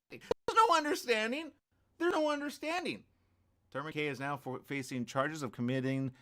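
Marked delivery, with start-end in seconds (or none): 0.1-1.5 high, loud There's no understanding; incredulous retort; indignant scoff. Real, not acted. Mocking the clinic's statement. 2.0-3.0 high There's no understanding, There's stressed; scornful repeat; indignant scoff. Real, not acted. Repeating the retort.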